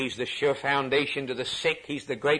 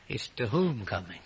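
"whom" weighted, the rest lighter; stress on WHOM; 4 dB quieter than usual. Shown as a demonstration. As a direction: lean on "whom". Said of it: "The emphasis here is not on the way".

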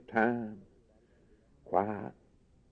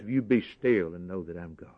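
softer, lower, still and hushed; hushed voice of God; 6 semitones lower, 6 dB quieter than usual. Retold or imitated. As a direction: lay it down gently like God speaking. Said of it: "and he says".

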